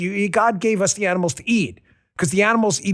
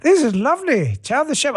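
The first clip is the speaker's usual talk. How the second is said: high-pitched imitation British accent; bad UK accent; 7 semitones higher than usual. Mimicking a British kid praising a brownie, bad UK accent. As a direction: put on a clumsy UK accent, pitched up. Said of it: "I don't do a good U K accent".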